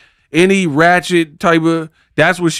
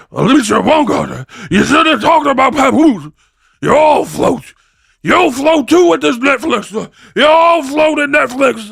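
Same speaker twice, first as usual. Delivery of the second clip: Pennywise impression; 10 semitones higher than usual. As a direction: gloat like a killer clown. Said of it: "I can't even get the impersonation".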